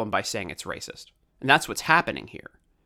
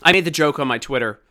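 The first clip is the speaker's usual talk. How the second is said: ordinary delivery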